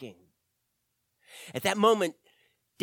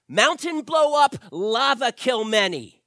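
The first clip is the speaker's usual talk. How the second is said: loud, higher, blunt broken English with a laugh; caveman-simple talk; 5 semitones higher, 7 dB louder than usual. Acted. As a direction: bark it out like a caveman explaining to a toddler. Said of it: "talk to me like, like I'm five".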